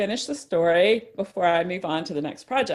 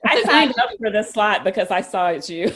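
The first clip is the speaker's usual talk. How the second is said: laughing; 3 semitones higher, 6 dB louder than usual. Real, not acted. Laughing while teasing the guests.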